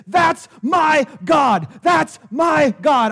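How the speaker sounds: slow, forceful preacher's shout; emphatic proclamation. Real, not acted. Preacher declaring his faith to the congregation, emphatic proclamation. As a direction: proclaim it, each word drawn out and driven home.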